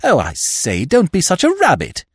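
put-on high, exasperated young gentleman; impatient protest; disrespectful outburst. A narrator's reading. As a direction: scold your uncle in a high, indignant voice. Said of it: "expressed himself in a manner far from fitting".

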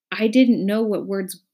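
plain reading voice

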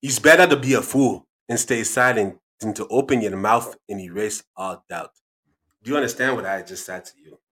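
put-on emphatic African-accented elder imparting wisdom. Dad delivers a quote about fools, African accent. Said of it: "African accent".

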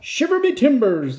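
high, hearty pirate exclamation. Acted. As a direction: ham up the pirate captain.

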